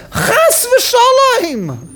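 high, very drawn-out exclamation; stretched, high-pitched protest; horrified denial. Acted. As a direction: cry it out as unthinkable. David's indignant denial, acted out.

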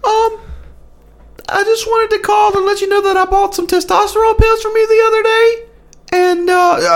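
very high, breathy prank caller voice; squeaky fake caller. Fake caller phoning the radio show, very high and nervous. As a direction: call in as a nervous squeaky customer.

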